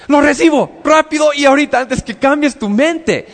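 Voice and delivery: eager grab. Pretend. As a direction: blurt it out fast, afraid he'll change his mind. Eager reply to God's offer. (aceptación gritada, rápida y aguda)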